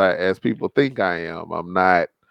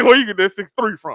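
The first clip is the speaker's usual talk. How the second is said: quoting Pop; 9 semitones higher than usual. Retold or imitated. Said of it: "Pop say".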